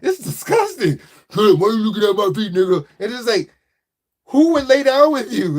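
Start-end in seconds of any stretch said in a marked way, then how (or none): 0.0-1.0 high, grossed-out mock voice; squealing in disgust, pitched up. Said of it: "it's just like". 1.3-2.9 fast mock comeback; defensive retort. The feet owner answering back.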